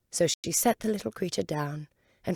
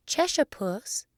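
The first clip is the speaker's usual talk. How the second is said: slow, higher-pitched tentative call; timid; 8 semitones higher, 3 dB louder, slower than usual. A narrator's reading. Alice first addresses the Cheshire Cat, timidly. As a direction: call out rather timidly, drawn out and high. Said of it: "she began rather timidly".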